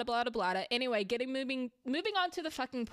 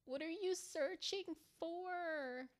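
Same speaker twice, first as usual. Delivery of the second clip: high, soft, slow coo to the dog; sweet-talking a pet that's chewing the couch; 7 semitones higher, 10 dB quieter, slower than usual. Real, not acted. Owner to her couch-chewing dog, a high soft slow coo.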